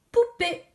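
"poupée" high and loud, syllables separated; dictated word. Shown as a demonstration. Teacher gives all pupils a word to write, high distinct "poupée". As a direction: call out "poupée" crisply for dictation. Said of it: "vous allez tous écrire".

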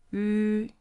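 Yu held high and level; first tone yu. Shown as a demonstration. Teacher models the first tone, high and flat. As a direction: keep Yu on one high flat pitch. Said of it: "high flat".

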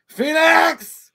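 hammed-up callout; mock villain call. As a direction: yell the name like a movie villain. Imitating villain name call.

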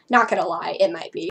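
plain reading voice

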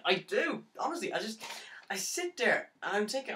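high, pleading self-defence; anguished protest. Acted. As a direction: plead your case in rising desperation. Anguished defence of loving her.

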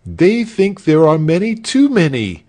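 high, loud indignant protest; hasty interjection. A narrator's reading. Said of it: "broke in hastily".

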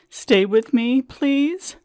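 wife begging her fading husband to stay with her; desperate plea. Retold or imitated. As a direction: beg him to stay. Begging husband not to drift.

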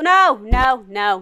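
ordinary delivery

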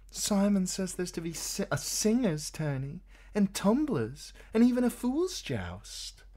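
put-on high, light young woman's voice; Shay's voice. A narrator's reading. Shay chattering to Tyrion in bed, high and eager.

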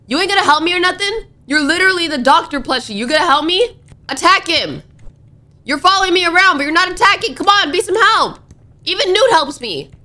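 high-pitched, indignant complaint; worked-up, high scolding; exasperated pleading. Real, not acted. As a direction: demand help from a useless sidekick. Scolds plushie for not helping.

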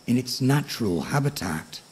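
plain reading voice

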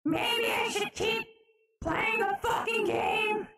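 high, slow, distorted squawk; distorted high-pitched creature; filtered Toad voice. Pretend. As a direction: drag it out in a screechy filtered voice.

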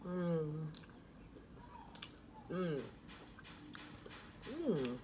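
humming while chewing; muffled mid-bite murmurs; mouth full of food. Real, not acted. Seen 3: fork with macaroni at mouth, then chewing through the hums.

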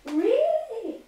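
high, drawn-out disbelief; surprised. Acted. Speaker reacting to news by phone, surprised. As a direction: react with astonishment, pitched right up.

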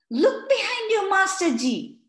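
high, loud excited squeal; squealing children. A narrator's reading. Children warn teacher about frog, squealing high and loud. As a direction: squeal it like thrilled kids. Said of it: "said Farah".

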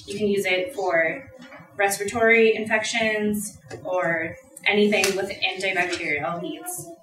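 normal talking voice

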